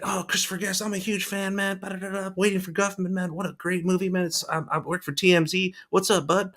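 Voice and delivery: high, eager fan voice; mock fanboy gushing. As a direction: gush at your idol like a starstruck fan. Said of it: "I'm like".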